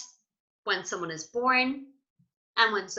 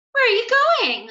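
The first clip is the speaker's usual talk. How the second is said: much higher, louder squeaky question; character voice; 13 semitones higher, 9 dB louder, faster than usual. Acted. Denzel asking the children where they go, high and loud.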